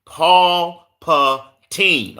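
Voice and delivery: "Paul Patine" very slow, in separate syllables; deliberate "Paul Patine", each syllable held apart; careful pronunciation. Real, not acted. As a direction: spell out "Paul Patine" by sound, drawn out. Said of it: "say his name is".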